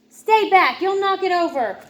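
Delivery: high, loud stay back; bossy warning. Acted. Felix warning his sister off his tower, loud. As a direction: warn her off sharply.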